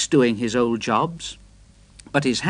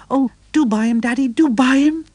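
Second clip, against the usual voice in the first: put-on much higher childlike begging voice; pleading boys; 10 semitones higher, 5 dB louder, faster than usual. A narrator's reading. The vicar's boys begging their daddy, high childlike pleading. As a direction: beg like little boys wanting a treat. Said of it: "The boys pleaded".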